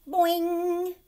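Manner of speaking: a high loud boing; loud high cartoon boing; springy boing. Shown as a demonstration. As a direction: bounce it like a spring. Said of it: "an exclamation point is".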